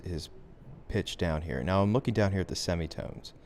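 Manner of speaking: ordinary talk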